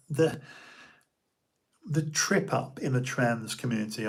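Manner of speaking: usual speaking voice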